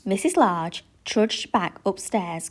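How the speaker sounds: ordinary delivery, nothing marked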